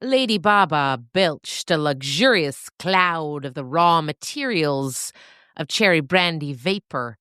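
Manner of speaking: plain reading voice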